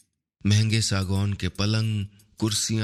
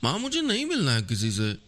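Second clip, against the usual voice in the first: higher, fast fretful whine; Akshara whining; 9 semitones higher, faster than usual. A narrator's reading. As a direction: complain to mom like a sulky girl clinging to her. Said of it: "माँ के पल्लू से लिपटी कुनमुनाती रही थी".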